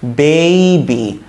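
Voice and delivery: "বেবি" stretched very slowly as bay-bee; slow, elongated "বেবি", bay then bee; drawn-out demonstration. Shown as a demonstration. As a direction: hold the "ei" glide in "বেবি" for teaching. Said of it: "আসল প্রনাউন্সিয়েশনটা কি?".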